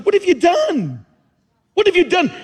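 God's voice. Retold or imitated. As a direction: call it out like God himself confronting. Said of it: "And God goes".

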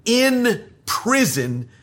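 "in" lifted high and drawn out slowly; slow stress on in prison. Shown as a demonstration. Preacher slowly lingering on "in prison" with stress. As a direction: stretch "in prison" slowly, lifting "in". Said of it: "those two words".

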